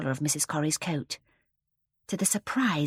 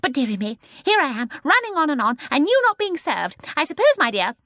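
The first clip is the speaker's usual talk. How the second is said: put-on high, loud, excitable little old lady; chatty old woman; 9 semitones higher, 7 dB louder than usual. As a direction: chatter on like a lively old shopkeeper. Voices old Mrs Corrie.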